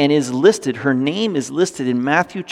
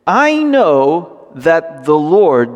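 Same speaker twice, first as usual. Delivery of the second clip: slow emphatic reading; much slower than usual. Shown as a demonstration. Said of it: "the word right there for Lord".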